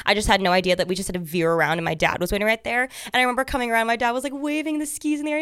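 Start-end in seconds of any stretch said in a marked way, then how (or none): none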